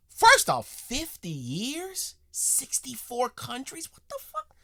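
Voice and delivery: high, loud incredulous outburst; can't believe what he just heard; stunned disbelief. Real, not acted. Reacting to the old soldier's quote, high incredulous disbelief.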